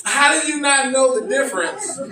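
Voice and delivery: retold baffled challenge; incredulous. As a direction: ask it like you cannot believe him.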